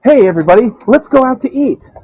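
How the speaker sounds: high, loud, breezy call; cheery grandfather. Retold or imitated. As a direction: sing out to the whole house. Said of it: "my grandfather comes home and says".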